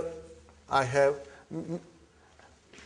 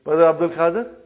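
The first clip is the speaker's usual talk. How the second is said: ordinary delivery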